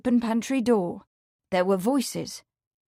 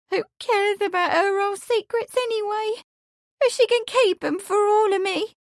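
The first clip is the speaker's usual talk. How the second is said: higher, tearful maid's voice; young woman, teary; 12 semitones higher, 3 dB louder than usual. A narrator's reading. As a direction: voice her as a hurt young maid. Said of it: "She gave another sob".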